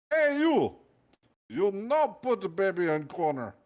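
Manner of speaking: loud, high Russian guard doing a movie hero; guard showing off his movie-hero impression, loud, in a Russian accent; Patrick Swayze impression. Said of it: "I do good Patrick Swayze impersonation".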